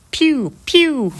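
high pew pew blaster noise; Star Wars blaster. Pretend. Playing with a blaster sound on a podcast, high pew pew. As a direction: zap it like a toy laser gun. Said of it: "me doing my Star Wars impression".

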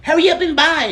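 high-pitched imitation of impatient staff; high, pushy caricature; mock restaurant staff. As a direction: rush them in a squeaky mock voice.

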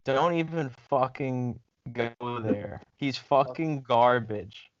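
ordinary talk, nothing marked

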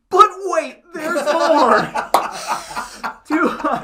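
high, loud, laughing pitchman yell; Billy Mays pitch. Retold or imitated. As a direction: shout it like a wild infomercial host. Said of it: "He's like".

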